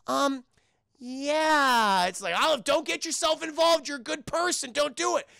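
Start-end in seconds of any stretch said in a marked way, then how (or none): 0.0-2.1 reluctant lie. Retold or imitated. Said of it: "Olive fucking lies to him". 2.4-5.3 high, raised mock pleading; urgent warning. Warning Olive not to get involved, urgent plea.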